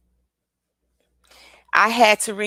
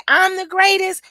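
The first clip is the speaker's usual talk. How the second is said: high-pitched confident declaration; proud affirmation; 11 semitones higher, 4 dB louder than usual. Shown as a demonstration. Showing how to say affirmations, confident and high. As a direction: say it like you mean it. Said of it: "when you say".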